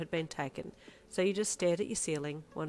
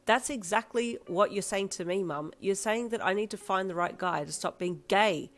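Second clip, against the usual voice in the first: heated protest; 4 semitones higher, 6 dB louder than usual. Acted. Daughter argues angrily with mum.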